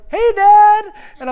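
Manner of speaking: high, loud imitated kid shout; excited little boy. Son on the field hailing dad, high excited yell. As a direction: call out high like a happy child.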